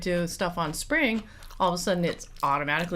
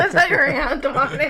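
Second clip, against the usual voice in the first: laughing; 8 semitones higher, 6 dB louder than usual. Real, not acted. Laughing at the photo.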